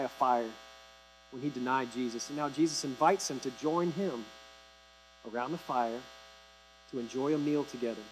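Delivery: tender, quiet retelling; hushed storytelling. A narrator's reading. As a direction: drop to a near-hush for the fireside scene. Hushed retelling of the fire scene.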